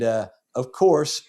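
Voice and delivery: ordinary talk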